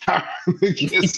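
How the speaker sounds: laughing while quoting. Retold or imitated. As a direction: giggle through the line. Quoting cartoon line, laughing.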